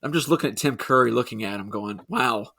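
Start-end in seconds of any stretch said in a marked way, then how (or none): none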